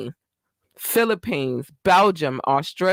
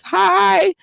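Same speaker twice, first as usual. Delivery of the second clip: squealed hi; 8 dB louder than usual. Real, not acted. Greeting Columbus listeners.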